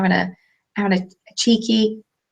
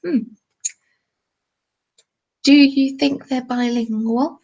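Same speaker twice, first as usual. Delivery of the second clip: slow, higher put-on voice of a stranger; curious eavesdropper; 4 semitones higher, slower than usual. Café customer at the next table wondering about them, slow put-on voice. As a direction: wonder slowly, as an impressed onlooker. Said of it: "we heard some people on the next table say".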